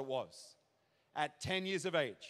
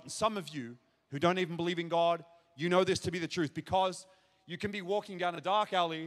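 ordinary delivery

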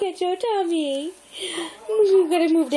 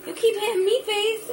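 ordinary delivery

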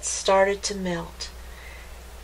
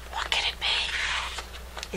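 soft, slow whisper; 8 dB quieter, slower than usual. A narrator's reading. Narrator wondering about the thing in the cave, whispered. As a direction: hush it like a secret.

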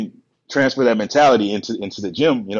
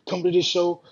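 imitating a let-down friend, high-pitched; hammed-up high, pleading reproach; disappointed complaint; 10 semitones higher than usual. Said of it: "he was disappointed".

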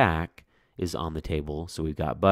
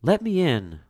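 pleading mother; 11 semitones higher, 5 dB louder than usual. Acted. Mother pleading at witch's door.

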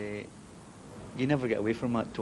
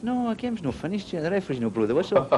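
very high, urgent mock protest; retold excited protest; 10 semitones higher than usual. Player telling his teammate the game isn't over, high excited protest.

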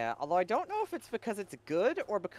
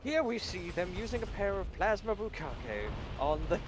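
nature documentary narrator voice; David Attenborough voice. Acted. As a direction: wildlife narration, lightly played. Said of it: "full-on David Attenborough voice".

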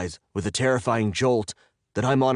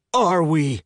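loud, high, angry finish; furious demand; 8 semitones higher, 5 dB louder than usual. A narrator's reading. Captive finishing his demand to his captor, furious. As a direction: land the question as a yell.

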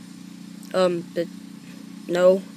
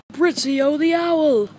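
higher, slow cast roll-call; movie credits announcer; 6 semitones higher, 3 dB louder, slower than usual. Acted. Kid reading the cast list, announcer voice. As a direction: announce each name like closing credits.

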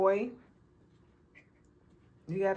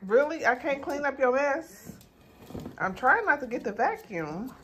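exasperated; 8 semitones higher, 5 dB louder than usual. Real, not acted. Exasperated at the dog.

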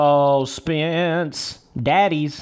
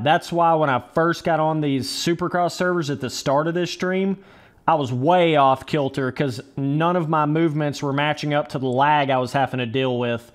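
ordinary talk

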